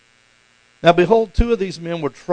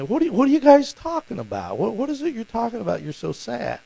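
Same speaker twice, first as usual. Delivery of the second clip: playful feigned ignorance; 8 semitones higher than usual. Pretend. Jesus questioning the sad travelers, playfully hiding a smile. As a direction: ask innocently while hiding a smile. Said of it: "it just seems like he would be kinda smiling".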